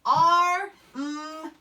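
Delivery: Ar-m broken into ar and m; teacher sounding out Ar-m; segmented arm. Shown as a demonstration. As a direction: pull Ar-m apart into its sounds. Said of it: "We know Y at the end".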